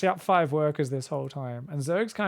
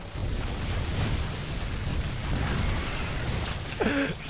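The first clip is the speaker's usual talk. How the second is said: breathy laugh; 6 semitones higher, 5 dB quieter than usual. Real, not acted. Laughter dying down.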